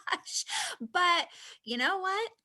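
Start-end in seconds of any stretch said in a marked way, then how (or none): 0.0-0.4 high, loud laughing exclamation; laughing oh my gosh. Retold or imitated. Embarrassed wife laughing at her husband's critique. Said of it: "I'm like".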